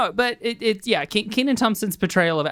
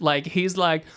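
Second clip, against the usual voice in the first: ordinary talk, nothing marked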